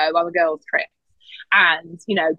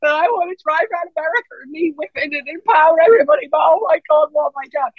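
much higher, excited then panicky; higher, faster giddy self-quote; 9 semitones higher than usual. Retold or imitated. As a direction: bounce between thrill and dread. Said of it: "I just was like".

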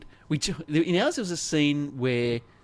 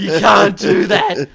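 slow, louder, heavy stress on that; emphatic repeat; 6 semitones higher, 13 dB louder, slower than usual. Retold or imitated. Repeating the warning to the kids, in a slow loud stern tone. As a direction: drag it out as a final stern refusal. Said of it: "my second one would be like".

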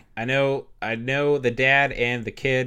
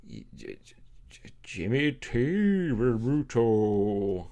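slow, stretched mock vampire voice; drawn-out vampire name; much slower than usual. Shown as a demonstration. Showing the vampire stretching out his name, very slow. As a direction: draw every syllable out long. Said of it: "he would say whatever the J T is out long".

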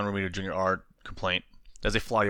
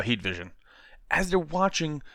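usual speaking voice

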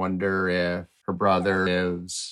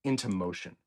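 bright, higher storytelling read; explainer read; 4 semitones higher than usual. Acted. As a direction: explain it like a kids' show host. Host reads the explainer.

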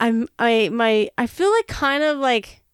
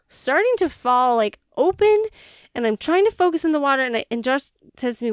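ordinary talk

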